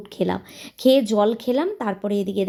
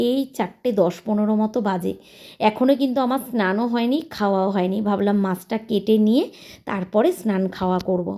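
normal talking voice

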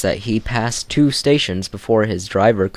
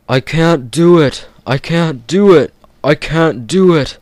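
loud, higher puffing engine chant; puffing Gordon; 7 semitones higher, 6 dB louder than usual. Acted. Gordon straining up the hill, loud puffing chant. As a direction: chug out the words in despair. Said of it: "puffed Gordon".

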